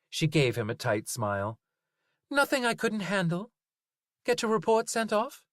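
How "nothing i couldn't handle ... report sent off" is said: higher, dry Zoe voice; Zoe, haggling with the butcher; Zoe's voice. A narrator's reading. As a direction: brush it off as Zoe. Zoe talks to Linus.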